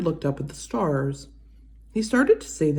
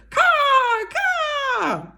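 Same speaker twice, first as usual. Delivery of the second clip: high, loud cawing crow; crow screaming; 3 dB louder than usual. Acted. Crow screaming at Edward from the pole. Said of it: "screamed a dark message".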